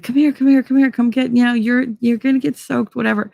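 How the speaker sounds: mock eager calling. Billy urging her out of rain.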